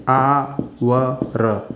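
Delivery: आवर stretched very slowly, opening on a long आ sound; drawn-out pronunciation. Shown as a demonstration. Teacher slowly sounding out आवर for students. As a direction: draw out आवर slowly so the silent h is clear. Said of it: "इसका उच्चारण करते हैं आ".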